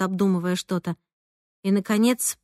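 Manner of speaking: usual speaking voice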